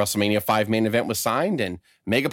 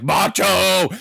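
higher, drawn-out mock holler; imitated yell; 5 semitones higher, 4 dB louder than usual. Hulk running back to Macho, imitated yell. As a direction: call out like a frantic wrestler. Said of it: "yelling Macho down the hall".

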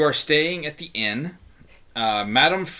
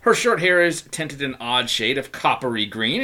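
normal talking voice

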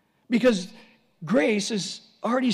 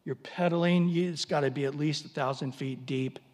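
normal talking voice

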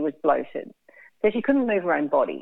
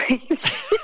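laughing. Retold or imitated. Confronting the neighbour, laughing through it.

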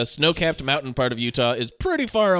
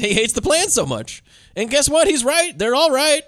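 excited; 10 semitones higher than usual. Real, not acted. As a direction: pitch jumps way up in gleeful indignation. Excited rant about the plan.

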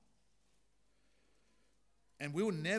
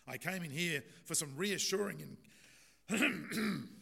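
coughing patient; 5 semitones higher, 6 dB louder than usual. Acted. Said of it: "Sounds like the actual patient".

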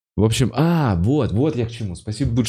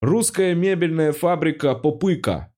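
higher, pitched-up advert read; ad announcer; 7 semitones higher than usual. Pretend. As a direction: announce the brand like a radio ad. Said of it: "с удовольствием говорил рекламу". (голос диктора рекламы)

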